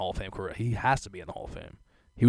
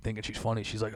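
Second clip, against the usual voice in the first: ordinary talk, nothing marked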